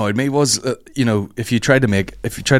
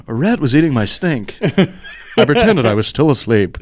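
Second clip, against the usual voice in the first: radio voice; 5 semitones higher, 3 dB louder than usual. Pretend. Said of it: "really good radio voice".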